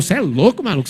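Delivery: loud, startled yell; shocked shout. Retold or imitated. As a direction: shout it like a stunned kid. Son shocked at father.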